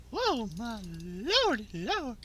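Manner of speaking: high, slow drawn-out amazement; hammed-up, drawn-out wonder; playful amazement. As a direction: stretch it out in high mock awe. Amazed at own kills.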